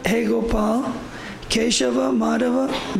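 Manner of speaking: ordinary talk, nothing marked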